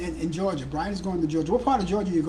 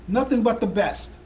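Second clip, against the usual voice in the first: higher, louder slogan with a falling close on "Nothing"; mock ad slogan; 5 semitones higher, 5 dB louder than usual. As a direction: punch "Nothing" like a pitchman. Repeats slogan as ad read.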